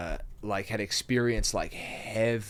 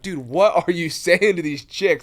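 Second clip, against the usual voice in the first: loud, high, incredulous mock protest; laughing disbelief; 7 semitones higher, 9 dB louder than usual. Retelling his reaction to a buddy's pickup line, laughing disbelief. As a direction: act out appalled disbelief through a laugh.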